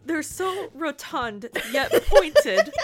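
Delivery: high, slow, wondering; excited George voice. A narrator's reading. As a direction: marvel at it like a fan.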